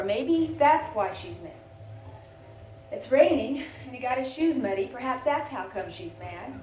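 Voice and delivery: ordinary talk, nothing marked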